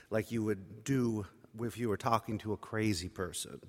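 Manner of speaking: ordinary delivery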